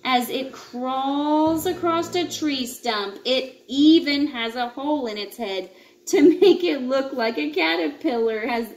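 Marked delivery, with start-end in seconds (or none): none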